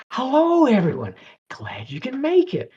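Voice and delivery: put-on high swoop into airy, whispery girlishness; girly voice. Shown as a demonstration. Animator demos greeting everyone as the avatar, girly voice. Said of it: "Do all that girly, mm, type of v-, voice stuff".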